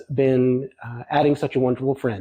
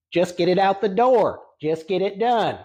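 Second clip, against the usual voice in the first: light Southern twang, a bit higher, quoting the mentor; 4 semitones higher than usual. Retold or imitated. Painter quoting his mentor's advice, in a light Southern twang. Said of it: "has a decidedly Southern twang".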